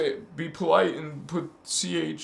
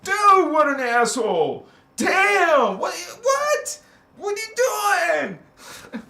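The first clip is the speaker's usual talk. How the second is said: high, loud disbelieving scolding; mock outraged shout; 12 semitones higher, 4 dB louder, slower than usual. Looking back at own past self, mock outraged shout. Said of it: "I look at my past, I'm like".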